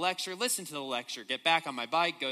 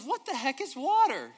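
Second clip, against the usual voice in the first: put-on young fish, quoted again; 8 semitones higher, 4 dB louder than usual. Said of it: "No, he says".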